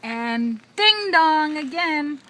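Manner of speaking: loud, slow dramatic dismay; mock alarm. A narrator's reading. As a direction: play up the alarm for the child. Reading to a toddler.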